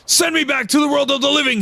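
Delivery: screamed. Acted. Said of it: "he screamed".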